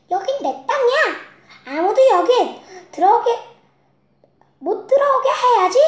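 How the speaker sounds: high, loud greedy pig. Acted. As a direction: bark it out like a selfish pig. Greedy pig claims land.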